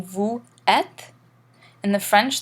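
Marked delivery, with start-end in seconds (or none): 0.0-1.1 "vous êtes" as two separate words, no Z between; no liaison. Shown as a demonstration. Teacher showing what not to read, "vous êtes" split without a Z. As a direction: split "vous êtes" with a silent S and rising end. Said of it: "instead of reading".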